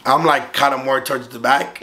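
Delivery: ordinary delivery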